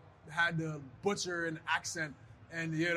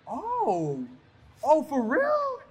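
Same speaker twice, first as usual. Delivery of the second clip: surprised; 8 semitones higher, 6 dB louder, much slower than usual. Retold or imitated. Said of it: "I said".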